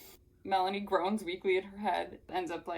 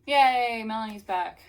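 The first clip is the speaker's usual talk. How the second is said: loud, high, drawn-out cheer; 4 semitones higher, 10 dB louder, slower than usual. Real, not acted. Reader cheering that Melanie's back, loud drawn-out cheer. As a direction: celebrate with a big hooray.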